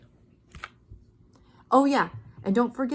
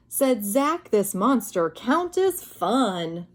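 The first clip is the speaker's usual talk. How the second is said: put-on reading to children; 2 semitones higher than usual. A narrator's reading. Teacher reading Monster Count to children, animated. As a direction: read the rhyme with bright wonder. Reads the story aloud.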